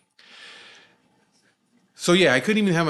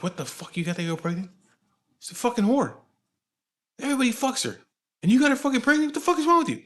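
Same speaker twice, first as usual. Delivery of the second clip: mad; 12 semitones higher than usual. Retold or imitated. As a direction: imitate an outraged elder's rising rant. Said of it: "He was mad about that".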